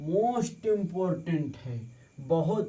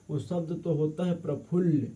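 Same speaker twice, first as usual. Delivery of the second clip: ordinary delivery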